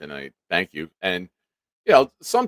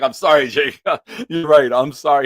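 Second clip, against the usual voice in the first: laughing apology; 9 semitones higher, 9 dB louder than usual. Retold or imitated. Laughing apology to angry friend.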